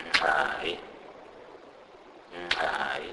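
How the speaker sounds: "nxai" with a nasal onset and click; Sepedi click demo. Shown as a demonstration. Teacher shows a sound not in English, clicked "nxai". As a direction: hum the N, click the X, draw out "ai" in "nxai". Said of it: "this sound is non-existent in English".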